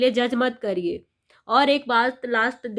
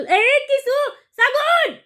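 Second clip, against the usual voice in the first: sudden high call across the room, much higher and louder; 13 semitones higher, 6 dB louder, much slower than usual. Real, not acted. Mother calling her child Sagun from the next room, loud high call.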